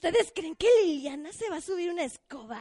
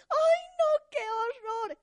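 dismayed witch cry; 10 semitones higher, slower than usual. Acted. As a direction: wail it like a frazzled witch. Witch Liliana dismayed by noise.

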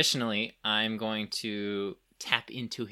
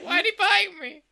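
high whiny voice; 6 dB louder than usual. Pretend. Party hurt after the fight.

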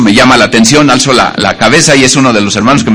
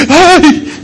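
startled cry; 12 semitones higher than usual. Retold or imitated. Despertar y ver a su esposa.

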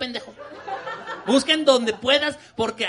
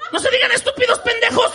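high, louder scolding yell; mom yelling; 10 semitones higher, 5 dB louder than usual. Retold or imitated. Mother scolding her two fighting sons, high loud yell. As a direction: bark at the fighting kids like mom.

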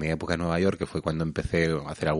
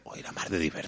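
whispered aside; 3 semitones lower, 11 dB quieter, faster than usual. Real, not acted. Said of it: "aside to co-host".

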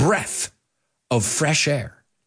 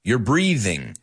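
ordinary talk, nothing marked

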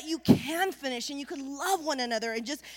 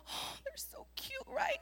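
high, very soft whisper; hushed cooing; 10 semitones higher, 12 dB quieter than usual. Acted. Onlooker adoring baby fawns in the lilies, whispered cooing. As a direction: whisper it as if adoring tiny babies.

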